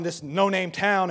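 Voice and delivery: ordinary talk, nothing marked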